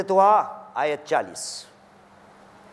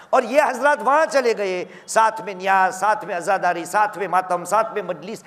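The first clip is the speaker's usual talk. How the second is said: rising and falling sermon delivery with chanted Quranic verses; impassioned preaching; 5 semitones lower than usual. Acted. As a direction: preach it to the gathering, swelling on the key lines. Majlis preaching throughout.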